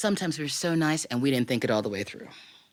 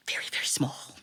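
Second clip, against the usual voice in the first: hushed emphasis; 5 dB quieter than usual. Real, not acted. Mother stressing daughter's tiny size.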